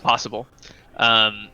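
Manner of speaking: usual speaking voice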